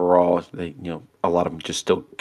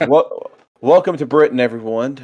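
put-on louder, higher second stab at a British accent; British accent retry; 5 semitones higher, 4 dB louder than usual. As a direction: open loudly in a makeshift British accent. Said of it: "It is a horrible British accent".

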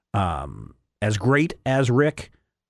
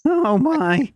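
much higher nervous Morty voice; a jittery teen stammering; Morty impression; 11 semitones higher, slower than usual. Host playing Morty to co-host's Rick, high nervous voice.

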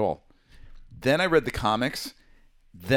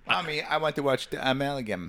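put-on kid voice; 4 semitones higher than usual. Imitating him as a child.

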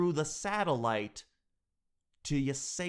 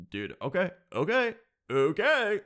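drawn-out, louder okays; mock reluctant okay; 6 semitones higher, 5 dB louder than usual. Clerk grudgingly agrees to tracking, drawn-out and mock. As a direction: give in slowly and grudgingly. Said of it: "And then he's like".

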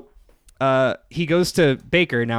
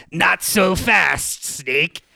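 villain taunt; 4 semitones higher, much slower than usual. Retold or imitated. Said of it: "he hears".

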